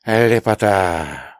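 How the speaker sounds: slow, drawn-out tsarist exclamation; Yakovlev as the tsar. Retold or imitated. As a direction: savour it like the film tsar. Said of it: "голосом Яковлева из Ивана Васильевича".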